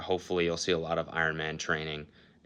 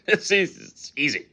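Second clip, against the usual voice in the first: laughing; 10 semitones higher, 4 dB louder than usual. Real, not acted. Joking about two more hours.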